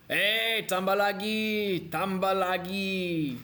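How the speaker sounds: high, loud "tambah lagi", drawn out slowly; pushy host urging. Acted. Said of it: "host urging guest to eat".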